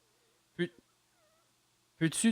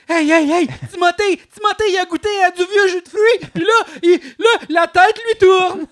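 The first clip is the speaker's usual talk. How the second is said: excited shouting; 14 semitones higher, 14 dB louder than usual. Retold or imitated. Bystander shouting about drunk Timothée.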